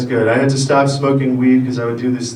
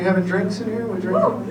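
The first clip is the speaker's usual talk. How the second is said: softer, higher quick question; casual asking; 6 semitones higher, 7 dB quieter than usual. Real, not acted. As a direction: check with the room, softly. First asks crowd about drinking.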